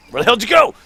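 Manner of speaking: loud call to someone upstairs; yelling across the room. Real, not acted. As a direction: shout it out to find him. Calling out for missing teammate.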